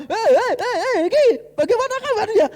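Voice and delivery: high, loud, bright hello to a friend; cheerful greeting. Retold or imitated. Preacher mimicking a cheery hello to a friend. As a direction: call out happily to a pal.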